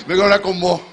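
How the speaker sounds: hammed-up Maracaibo devil; Maracucho accent. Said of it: "Es un diablo maracucho". (diablo maracucho exagerado y más fuerte)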